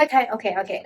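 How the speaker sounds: ordinary delivery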